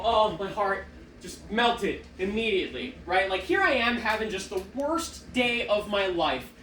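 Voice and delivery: ordinary delivery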